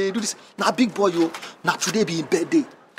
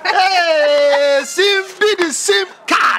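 calling out; 9 semitones higher, much slower than usual. Acted. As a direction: holler the nickname across the yard. Calling out to card seller.

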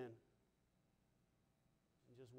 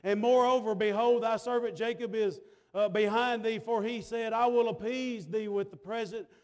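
steady, driving pulpit delivery; impassioned sermon cadence; preaching; 2 semitones higher, 7 dB louder than usual. Real, not acted. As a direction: preach it out to the congregation. Preaches with force.

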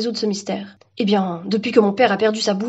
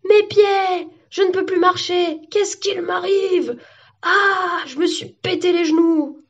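high-pitched cries of distress; hammed-up shrieking victim; panicked wail; 9 semitones higher, slower than usual. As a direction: wail as your feet vanish. Victims lose their feet.

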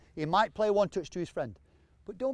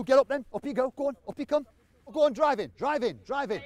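loud, raised coaching calls; shouting encouragement across the training ground; calling out; 6 semitones higher, 3 dB louder than usual. Real, not acted. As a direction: call them up the pitch from a distance. Coach calling players up the pitch.

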